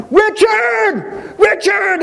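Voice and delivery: high, mock search-party call across the cliffs; hammed-up distant shout of a name. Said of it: "that they're like".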